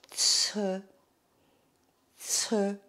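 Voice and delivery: "tse" as ts plus French eu; drawn-out tse. Shown as a demonstration. Teacher shows the easier C E, "tse" with long hiss. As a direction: model "tse" twice, each alone. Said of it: "Le C E".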